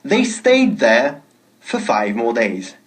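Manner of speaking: slow model sentence. Shown as a demonstration. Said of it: "teacher models sentence".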